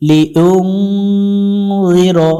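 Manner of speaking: লিইউনযিরা drawn out slowly with a light, thin জি; correct recitation. Shown as a demonstration. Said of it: "জি পাতলা করব". (লিইউনযিরা ধীরে, পাতলা জি দিয়ে)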